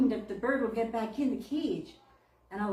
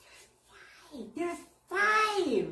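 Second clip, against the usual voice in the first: amazed counting; 9 semitones higher, 4 dB louder, much slower than usual. Retold or imitated. Friend stunned counting birds.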